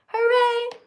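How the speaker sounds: high, loud cheer. Pretend. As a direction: shout a happy hooray. Cheer after song.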